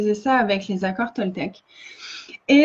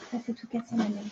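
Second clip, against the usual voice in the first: much softer, talking to the dog; quiet aside; 12 dB quieter, much faster than usual. Real, not acted. Aside to her dog after a crash. (aparté à voix basse)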